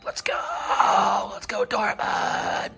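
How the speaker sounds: high unvoiced whisper shout; whisper screaming. Real, not acted. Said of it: "that's why I'm whisper screaming".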